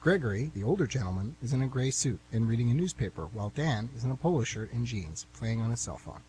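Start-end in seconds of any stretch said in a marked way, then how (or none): none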